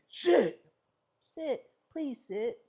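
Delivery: high, drawn-out pleading sits, slow; pleading command. Shown as a demonstration. Trainer mocking owners who plead with their dog. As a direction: beg the dog to sit, slowly. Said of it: "always changing your tone".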